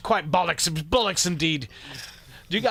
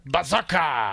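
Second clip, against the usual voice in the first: loud mock Russian shout; comic Russian exclamation; Russian accent; 4 dB louder, slower than usual. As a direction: bark it in a put-on Russian accent. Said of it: "think of Berserker. Remember?".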